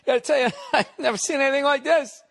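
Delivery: high, loud, fast voice cracking with laughter; laughing contractor. Retold or imitated. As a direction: squeal it out through laughs like the amused contractor. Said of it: "the guy was laughing".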